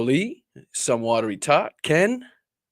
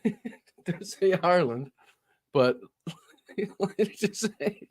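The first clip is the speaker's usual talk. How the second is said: higher voice breaking into giggles; laughing; 7 semitones higher, 4 dB quieter than usual. Real, not acted. Host reading out the shirt, laughing. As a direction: try to talk through laughter. Amused by own shirt mistake.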